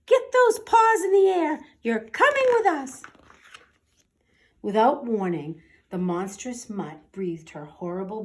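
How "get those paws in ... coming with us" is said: high, loud squeaky mouse officer; little mouse officer barking orders at the dog. Acted. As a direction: squeak it out like a tiny bossy officer. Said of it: "squeaked the Big Cheese".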